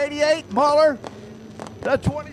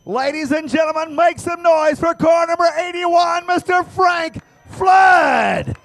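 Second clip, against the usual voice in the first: booming pumped announcer; 5 semitones higher, 6 dB louder than usual. Acted. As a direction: shout the winner's name to the stands. Announcer calling for crowd noise.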